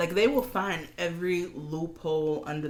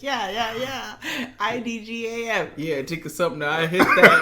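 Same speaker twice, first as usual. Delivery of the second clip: Toni voice; 6 semitones higher, 6 dB louder than usual. Retold or imitated. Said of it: "when she put on her Toni voice".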